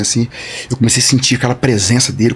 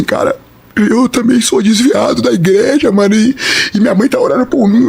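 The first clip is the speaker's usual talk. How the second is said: imitating a man sobbing out a confession; crying; 6 semitones higher than usual. Shopkeeper confesses he left the church too, crying. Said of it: "Aí ele começou a chorar".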